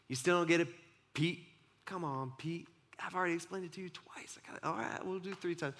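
soft, smiling, patient ribbing; gentle teasing. Retold or imitated. Jesus gently teasing Peter, soft and smiling.

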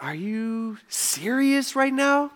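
exasperated disbelief. Acted. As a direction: let the incredulity stretch out. Jesus exasperated at disciples.

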